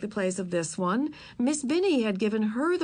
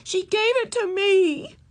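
much higher, louder, slow tearful correction; girl fighting tears, much higher and slow; fighting back tears; 12 semitones higher, 6 dB louder, slower than usual. Acted. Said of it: "as she fought back tears".

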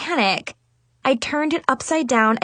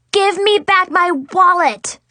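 much higher, louder and slower, each word hit; screeching demand; 8 semitones higher, 6 dB louder, slower than usual. A narrator's reading. Big sister demanding her wallet from her sister, screeching. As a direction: demand it back at full volume. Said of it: "I screeched".

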